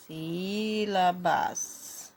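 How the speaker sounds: SÍ-LA-BAS stretched syllable by syllable; very slow SÍLABAS, every vowel held; drawn-out dictation. Shown as a demonstration. Repeats word slowly while writing. (SÍLABAS bem esticado, sílaba por sílaba)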